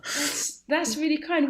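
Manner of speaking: high, faux-sweet sarcasm; sugar-coat the insult; syrupy fake kindness; mock sweetness. Mock sweet reply in improv insult.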